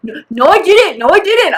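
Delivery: imitated kid's denial. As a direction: mimic a child insisting on innocence. Imitates kid denying it.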